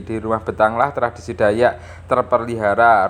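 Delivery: ordinary talk, nothing marked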